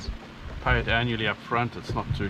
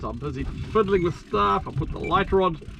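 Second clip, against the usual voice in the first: normal talking voice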